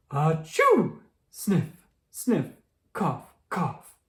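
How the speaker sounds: sneezing and sniffling noises; a stuffy sneeze, then coughs; sniffles sneeze and cough. Acted. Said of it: "Pedro has the sniffles".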